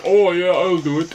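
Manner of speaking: a light Scottish accent, barely put on; a passing hint of Scottish in the voice. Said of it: "that's my Scottish accent".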